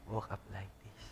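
mocking aside. Shown as a demonstration. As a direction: mutter "Woke up like this" under your breath, teasing. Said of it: "repeats selfie caption mockingly". (pabulong at nanunuya na "Woke up like this")

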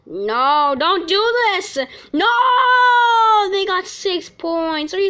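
high, loud crying shout; real despairing cry; anguished no. Real, not acted. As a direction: wail it in despair. Opponents score, anguished cry.

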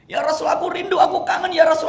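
high, loud wailing cry; tearful shout. Acted. Thauban embracing the Prophet, high loud tearful cry. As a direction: howl your longing at him.

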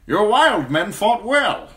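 put-on hearty older knight, pleased; lightly voiced approving knight; knight's voice. A narrator's reading. Sir Kevan voiced in narration.

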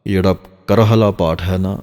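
usual speaking voice

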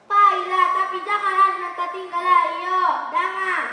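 loud, firm motherly warning. Acted. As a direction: call out the warning firmly.

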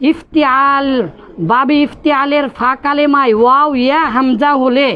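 usual speaking voice

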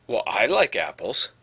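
"like" pushed with a rise on the word; contrastive emphasis. Shown as a demonstration. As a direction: insist on "like", voice lifting. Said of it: "there's a different intonation there".

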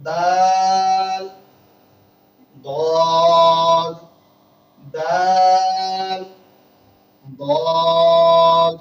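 দাল held very long and loud, দদ short and loud; drawn-out Arabic letter names. Shown as a demonstration. Teacher sounding out letters for pupils: long loud দাল, short দদ. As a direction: sound out দাল slowly, clip দদ, for the class. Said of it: "সকলে বোর্ডে লক্ষ্য করুন".

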